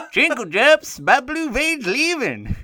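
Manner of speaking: high, slow crackly prospector; awful prospector. Pretend. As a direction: croak like an old prospector. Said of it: "my awful prospector".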